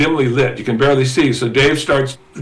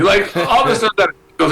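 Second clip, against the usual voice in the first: ordinary talk, nothing marked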